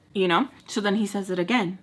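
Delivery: ordinary talk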